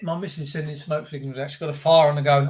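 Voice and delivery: normal talking voice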